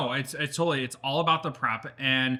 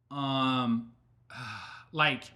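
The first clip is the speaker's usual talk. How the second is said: normal talking voice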